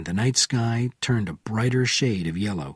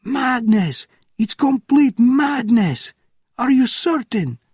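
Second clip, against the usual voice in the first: very high, loud scream; 14 semitones higher, 5 dB louder, slower than usual. A narrator's reading. Officer on the phone screaming in disbelief, very high and loud. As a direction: scream it at the top of your lungs. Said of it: "screamed at the top of his lungs".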